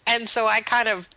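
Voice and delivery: usual speaking voice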